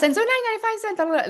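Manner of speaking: mock nervous Mickey Mouse voice quoting a price; Mickey Mouse squeak. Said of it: "sounding like Mickey Mouse".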